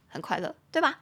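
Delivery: ordinary talk, nothing marked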